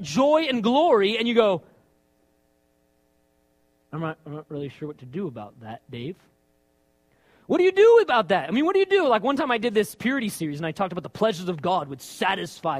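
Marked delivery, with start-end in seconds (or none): 3.9-6.2 softer, lower, hesitant reply; a lightly voiced puzzled listener; unsure mumble. Guy unsure how to respond. 7.5-9.1 louder, higher exclaiming; a fired-up preacher asking aloud; emphatic question. Real, not acted. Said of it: "preacher's rhetorical exclamation".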